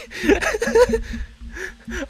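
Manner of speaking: breathy high-pitched giggle; high giggling laugh. Real, not acted. Laughing at own joke.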